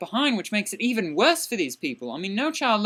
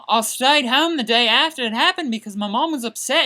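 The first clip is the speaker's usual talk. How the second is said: ordinary talk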